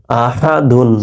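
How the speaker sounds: ordinary delivery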